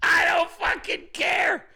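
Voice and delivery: building to a shouted, drawn-out I don't fucking care; mock angry outburst. Mocking the friend as he blows up at the critics, with a hammed-up shout. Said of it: "I'm just like".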